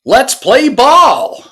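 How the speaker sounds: drawn-out, high American yell; American accent shout. Retold or imitated. Streamer quotes Gabe at kickoff, American accent shout. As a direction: holler it long and loud in an American accent. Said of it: "as Gabe says ... doing the American accent".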